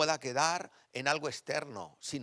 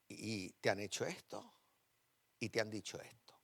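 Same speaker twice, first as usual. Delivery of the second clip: tender prophetic word; 3 semitones lower, 6 dB quieter than usual. Retold or imitated. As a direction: speak it gently, like a comfort from God. Retold prophetic word to pastor.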